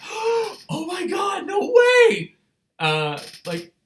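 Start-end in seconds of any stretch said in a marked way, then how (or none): 0.0-2.3 high, loud starstruck shriek; fan squeal. Retold or imitated. Fan inwardly screaming at a star's compliment, high and loud. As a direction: squeal it like a thrilled fangirl.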